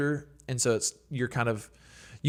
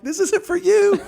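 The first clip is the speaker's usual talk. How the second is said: much higher, louder mock shooing; mock retort; 8 dB louder, slower than usual. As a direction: squeal it like a playful brush-off. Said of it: "I'm like".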